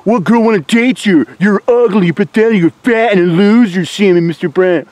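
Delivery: mocking the trolls.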